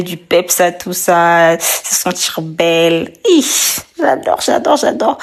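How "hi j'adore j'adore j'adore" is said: excited. Real, not acted. Excited about makeup.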